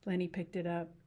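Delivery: ordinary delivery, nothing marked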